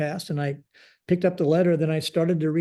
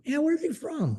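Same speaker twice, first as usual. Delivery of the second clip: much higher, insistent question; emphatic repeat; 12 semitones higher than usual. Retold or imitated. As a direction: press the point, incredulous.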